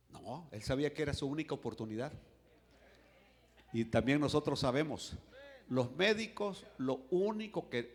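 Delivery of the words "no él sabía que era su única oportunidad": ordinary delivery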